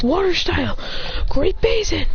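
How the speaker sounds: high-pitched anime move call; mock battle incantation; calling an attack. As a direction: announce the technique with dramatic weight. Character calls out attack move.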